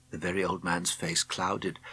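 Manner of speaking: ordinary delivery, nothing marked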